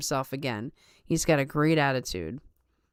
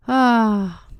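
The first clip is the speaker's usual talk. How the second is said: ordinary talk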